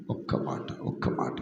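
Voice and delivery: hushed unvoiced whisper. Preacher hushing the congregation, soft whisper. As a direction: drop to a secret whisper. Sudden hush after loud preaching.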